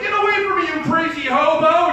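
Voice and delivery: high, loud shooing-away shout; hammed-up alarmed holler; panicked yelling. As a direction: yell to drive off a stranger. Acts out shooing a park stranger.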